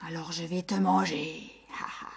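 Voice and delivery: menacing monster. Acted. Monster threatens to eat the shepherd. (menace de monstre, voix grave)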